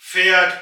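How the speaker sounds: फैट with a flat ए sound, said loudly; correct German sound. Shown as a demonstration. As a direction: say फैट with the ए flattened, loud and clear. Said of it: "इसलिए हम इसको फैट पढ़ेंगे". (फैट को चपटे ए के साथ ज़ोर से बोलें)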